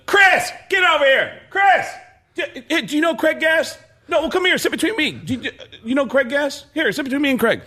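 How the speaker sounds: high, pushy table-side hollering; mock calling out. Comedian retelling Belzer hailing Walken at dinner, mock calling out. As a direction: call out excitedly, pitched well up.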